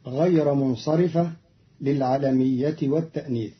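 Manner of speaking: ordinary talk, nothing marked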